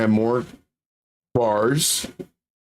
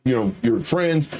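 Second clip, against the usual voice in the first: ordinary delivery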